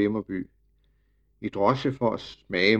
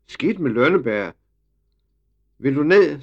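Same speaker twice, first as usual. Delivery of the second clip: put-on boy's shout, louder and higher at the end; yelling; 5 semitones higher, 6 dB louder than usual. A narrator's reading. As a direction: yell it up to someone overhead. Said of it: "skreg Emil".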